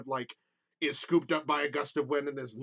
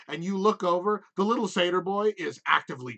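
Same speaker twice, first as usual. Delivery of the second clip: ordinary talk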